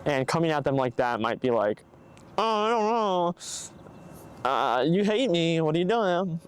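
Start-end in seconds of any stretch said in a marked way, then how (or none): 2.4-6.4 higher, put-on protesting voice; exaggerated hurt protest, raised in pitch; mock defensive. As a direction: imitate someone whining back at criticism. Acted defensive reply to being confronted.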